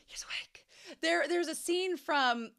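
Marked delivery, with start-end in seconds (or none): 0.1-0.7 hushed, voiceless whisper; imitated cartoon whisper. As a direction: whisper it like a startled cartoon character. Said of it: "the way he says it".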